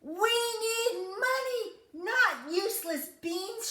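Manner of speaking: high, loud angry cry; mother furious at Jack over the beans. Acted. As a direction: scold him at full voice. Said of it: "she cried".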